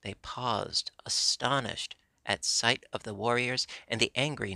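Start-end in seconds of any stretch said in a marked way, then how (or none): none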